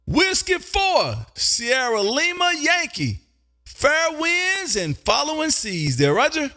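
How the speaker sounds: high, stretched-out call sign; drawn-out radio sign-off. Real, not acted. Host signs off to a departing radio caller, drawn-out sign-off. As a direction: send the caller off in long, ringing words.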